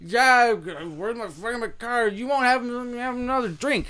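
high, slurring drunk imitation; lightly played drunk, slow at the end; mock drunk. Bartender imitating the drunk demanding a drink. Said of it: "And he's like".

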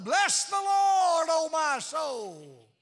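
loud, slow, hands-up hollering; hollered praise. Pretend. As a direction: holler it out to heaven, stretched long. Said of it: "threw up both hands and hollered".

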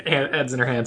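ordinary delivery

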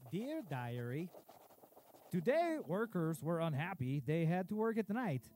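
ordinary talk, nothing marked

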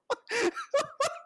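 real high laughing fit. Real, not acted. Laughing at Mark story.

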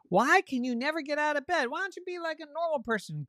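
high, rapid nagging questions; exasperated sister. Acted. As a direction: pester her in a high, hurried voice. Sister scolds Avery in story.

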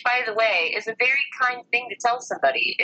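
ordinary talk

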